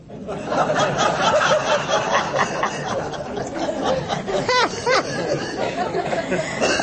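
high airy laugh that runs on; long amused giggle; long breathy laugh. Real, not acted. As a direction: laugh helplessly at the joke. Long laugh after joke.

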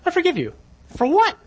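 mock indignant high voice; baffled mock reply. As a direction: play the stranger's incredulous squeak. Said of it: "a man I've never seen before in my life saying".

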